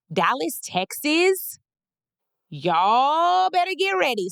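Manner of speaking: rally Dallas to get ready; excited hype. Real, not acted. Hyping her hometown crowd about the show, excited and drawn out.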